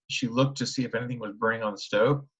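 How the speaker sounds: ordinary delivery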